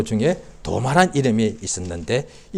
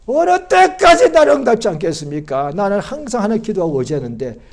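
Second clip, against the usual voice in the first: high, loud acted plea, easing off; pleading lament; 8 semitones higher, 6 dB louder than usual. Psalmist asking God how long, pleading lament. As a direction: cry out, then let it sink.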